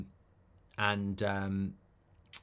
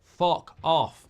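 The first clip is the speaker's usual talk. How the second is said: a mock snarl, louder; retold angry snap; 5 semitones higher, 7 dB louder than usual. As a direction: bite it off like telling someone off. Retold rant at rude person.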